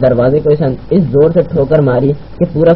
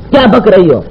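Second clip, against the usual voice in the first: higher-pitched, sharp exclamation; outraged rebuke; 5 semitones higher, 4 dB louder than usual. Acted. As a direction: snap at an absurd claim. Officer outraged by her refusal. (غصے سے بھڑک کر)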